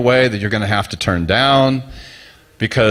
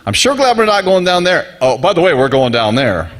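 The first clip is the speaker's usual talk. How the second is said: mock relief; 6 semitones higher than usual.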